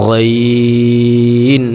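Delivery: গইন drawn out slowly with a gargle in the throat; gargled ghain. Shown as a demonstration. Teacher showing students a gargled গইন. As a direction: rattle গইন in the throat, long and chanted. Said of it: "একটু গড়গড় করে".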